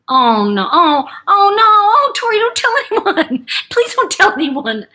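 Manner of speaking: high, loud mock dismay; mortified father. Daughter mimicking her swindled dad pleading, mortified. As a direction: imitate a panicked, pleading wail.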